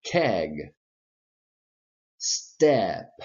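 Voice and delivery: isolated emphatic words, each with a clear eh vowel; repeat-after-me drill. Shown as a demonstration. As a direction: punch out each word, then pause for students. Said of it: "Repeat when my hand comes towards me".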